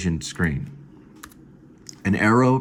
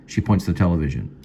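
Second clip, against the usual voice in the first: ordinary talk, nothing marked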